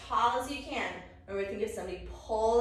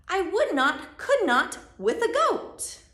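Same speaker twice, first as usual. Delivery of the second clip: high, loud, mounting protest; emphatic refusal; 6 semitones higher, 10 dB louder, slower than usual. Acted. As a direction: refuse dramatically, stretching the last phrase. Acts the story's refusal.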